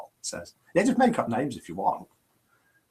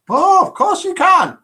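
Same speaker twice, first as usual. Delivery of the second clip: much higher, louder breezy retort; cheery mock assistant; 8 dB louder than usual. Assistant answering the pharmacist, chirpy high retort. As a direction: brush the scolding off, chirpy and high.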